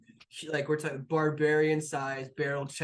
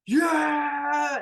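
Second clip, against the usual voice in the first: high, loud crowd cheer; 12 semitones higher, 9 dB louder than usual. Acted. Crew hears the captain clap, cheering loudly. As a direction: let out a drawn-out whoop.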